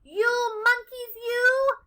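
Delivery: high, loud, drawn-out scolding; exasperated storybook peddler. A narrator's reading. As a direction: tell them off at full voice. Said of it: "he spoke to them".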